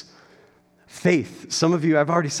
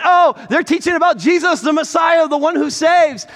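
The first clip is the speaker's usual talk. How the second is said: put-on calling girl; 12 semitones higher, 7 dB louder than usual. Girl following apostles calling out.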